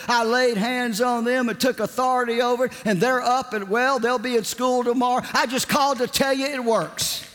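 high-pitched, breathless-run testimony in a mimicked woman's voice; sustained high, emphatic mimicry; excited caller. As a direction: imitate a woman gushing her news over the phone.

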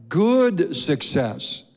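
"good" drawn out slowly and punched; emphasis on good. Real, not acted. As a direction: lean hard on "good", then land on "success". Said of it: "notice I emphasize the word good".

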